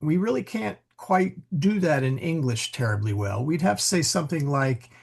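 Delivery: ordinary talk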